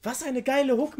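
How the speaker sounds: high-pitched, thrilled exclamation; excited praise. Real, not acted. Reactor raving about the hook's melody, excited and high. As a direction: rave about it, pitch jumping up.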